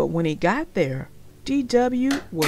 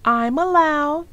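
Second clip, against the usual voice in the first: put-on high, loud kid voice insisting; a stubborn little girl; high insistent little sister; 7 semitones higher, 6 dB louder than usual. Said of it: "said D W".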